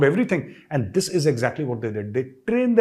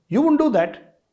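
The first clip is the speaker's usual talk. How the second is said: high emphatic denial; 12 semitones higher, 4 dB louder, slower than usual. Real, not acted.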